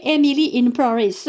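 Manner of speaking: Emily in Paris in a playful, put-on English accent; hammed-up English accent. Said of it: "avec mon superbe accent". (accent anglais exagéré pour rire)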